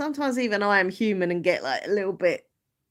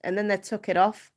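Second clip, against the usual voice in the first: put-on Scouse, chatty introduction; amateur Liverpool accent, a little self-conscious. Shown as a demonstration. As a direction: do your best Liverpudlian. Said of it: "that's my Liverpool accent".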